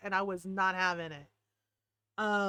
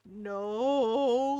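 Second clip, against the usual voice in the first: lightly imitated sheepish child; little kid. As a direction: draw out a small, childlike "no". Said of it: "like a little kid".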